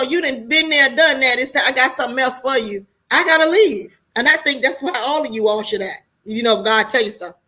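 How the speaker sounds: usual speaking voice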